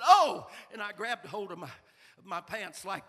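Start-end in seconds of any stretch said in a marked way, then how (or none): 0.0-0.5 sudden loud, high yelp; cry of pain. Retold or imitated. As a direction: yelp as the sting hits. Hornet sting, crying out.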